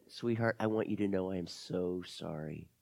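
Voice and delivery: soft, low condolence; tender sympathy. Acted. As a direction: console a hurting child gently. Lizzie comforts heartbroken Camila.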